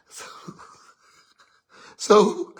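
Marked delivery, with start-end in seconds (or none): none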